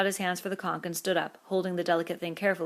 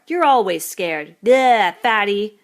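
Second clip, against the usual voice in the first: taunting; 4 semitones higher, 14 dB louder, slower than usual. A narrator's reading. Jack taunting Piggy.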